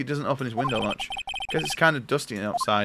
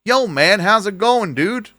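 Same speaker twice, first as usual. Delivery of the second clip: a light, higher, louder mock American dude; mock American accent; 7 semitones higher, 6 dB louder, slower than usual. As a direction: ham up an American surfer dude. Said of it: "And I was just like".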